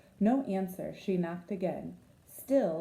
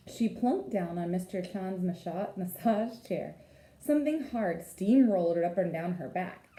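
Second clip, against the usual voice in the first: usual speaking voice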